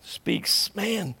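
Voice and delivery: ordinary talk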